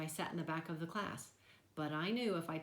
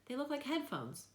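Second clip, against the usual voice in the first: much higher, bright reassurance; cheerful; 9 semitones higher than usual. A narrator's reading. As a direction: brighten up to sell it. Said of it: "trying to be cheerful".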